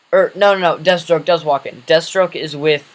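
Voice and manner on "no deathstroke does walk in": ordinary talk, nothing marked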